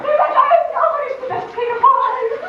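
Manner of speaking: haughty outraged wife; indignant refusal. Acted. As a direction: fling the refusal back at him. Refusing the Bluebeard game.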